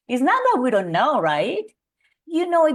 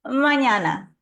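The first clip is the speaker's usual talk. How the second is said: usual speaking voice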